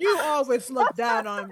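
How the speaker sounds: loud, hammed-up complaint; overacted stage accusation; mock theatrical. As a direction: play a staged grievance, loudly. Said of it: "he's putting on a little theater for us there".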